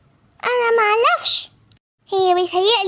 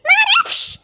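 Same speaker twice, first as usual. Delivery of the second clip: even higher, rising panicked cry; panicked shout, pitched higher; 11 semitones higher, slower than usual. Acted. A kid still panicking over the diaper, shouting higher. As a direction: push the panic further on the repeat.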